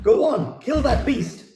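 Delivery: sending the husband off to fight a beast; calling after him. Acted. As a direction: call loud and high after your husband down the hall. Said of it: "she calls after him".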